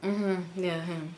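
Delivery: low, quiet, unenthusiastic reply; subdued, minimal acknowledgement; retold curt confirmation. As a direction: confirm it under your breath. Acts her reluctant short answer.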